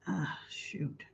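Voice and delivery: breathy whispered aside to herself. Real, not acted. Whispering to herself as her live comments suddenly flood. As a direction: drop to a quick whisper, thinking aloud.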